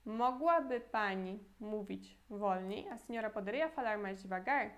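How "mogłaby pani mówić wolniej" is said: slow second model of the same question; slow teaching repeat. Shown as a demonstration. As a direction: repeat it slowly so they can copy. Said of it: "de novo".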